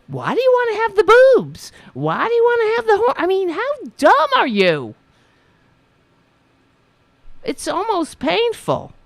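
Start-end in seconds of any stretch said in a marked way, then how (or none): 0.1-4.9 high, fast, sneering imitation of a questioner; high mocking parody voice. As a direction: parody the dumb questioner, pitched way up. Mimicking the questioner again.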